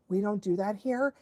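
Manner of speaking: soft, understated leader voice; calm correction. Acted. Leader dog stepping in, calm and quiet. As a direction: set the rule quietly, no fuss. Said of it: "calmly just went over and was like".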